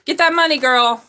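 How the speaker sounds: loud, hyped cheer; loud hype for the client. Real, not acted. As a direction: pump her up, loud.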